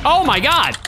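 sudden loud, drawn-out exclamation; shocked gamer yelp; startled outburst. Real, not acted. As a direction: blurt it out, caught off guard mid-sentence. Suddenly beaten mid-sentence in game.

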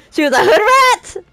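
high, laughing mock question. Real, not acted. Joking to viewers about the story, laughing high. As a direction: giggle the punchline out.

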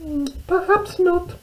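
very high, squeaky mouse voice; little mouse. Acted. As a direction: squeak it out like a tiny storybook mouse. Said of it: "said Little Mouse".

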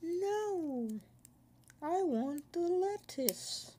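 high, slow squeaky character voice; squeaky cartoon voice. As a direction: play a whiny cartoon critter. Silly high-pitched character voice.

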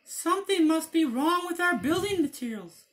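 very high squeaky wolf voice; little wolves. Acted. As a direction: squeak it like puzzled cubs. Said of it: "they said".

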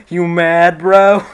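loud, high mock taunt through a laugh; taunting troll. Imagined troll walking up to gloat, mocking taunt. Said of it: "like, the troll face".

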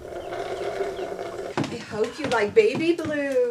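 ordinary talk, nothing marked

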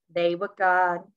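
usual speaking voice

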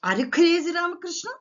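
high-pitched question rising on the name; mock interrogation. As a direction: challenge him, lightly acted, voice lifting. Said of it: "what are the questions he put to Ramakrishna".

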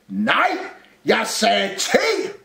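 high, slow insistent yell; shouted correction. A narrator's reading. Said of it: "råbte Rumle". (råber insisterende)

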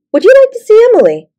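high, fast, eager offer; bright and eager. Acted. Changes subject brightly after laughing.

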